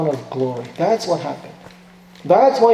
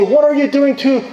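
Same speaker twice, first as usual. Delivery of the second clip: higher, urgent cry of protest, not full shouting; outraged protest; 9 semitones higher, 4 dB louder than usual. Retold or imitated. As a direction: call out to stop the soldiers, mock-earnest outrage. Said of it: "boil in rage and say".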